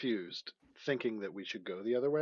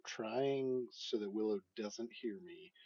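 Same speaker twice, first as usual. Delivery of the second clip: usual speaking voice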